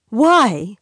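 wife overriding her teasing husband, loud; loud demand. Acted. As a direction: cut him off hard. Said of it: "Catherine said loudly".

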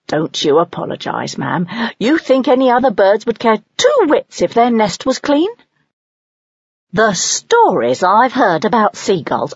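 a hint of a proud old servant; lightly voiced snake. Nest snake reassuring the mother owl, lightly voiced. Snake scolds other birds.

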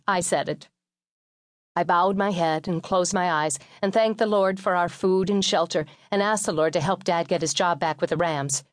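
ordinary delivery

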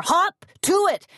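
high, loud bellow; bellowed order. A narrator's reading. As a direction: bark the order at the kids. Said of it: "bellowed Mama".

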